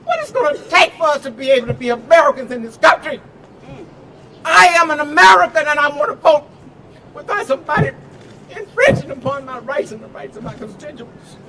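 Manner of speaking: shouted fiery rally oratory; rally speech. Real, not acted. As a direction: preach it to the crowd, full force.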